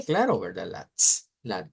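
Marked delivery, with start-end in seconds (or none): 0.9-1.3 ts alone, pure unvoiced release; voiceless t sound. Shown as a demonstration. Teacher showing T against D, voiceless ts. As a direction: release ts without any voice. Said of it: "aquí está la T y la D".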